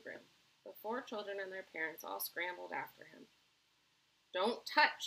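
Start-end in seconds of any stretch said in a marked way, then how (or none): 4.3-5.0 loud, sharp storybook warning; shouted warning. A narrator's reading. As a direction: call out a warning. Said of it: "shouted Mister Wonka".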